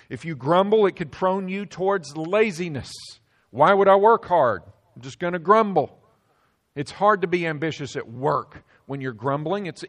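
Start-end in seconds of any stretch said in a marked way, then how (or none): 3.5-6.0 loud, mocking grumbler's complaint; loud mock whine of a grumbler; lazy grumbler. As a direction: sneer it out like a lazy worker who can't be bothered.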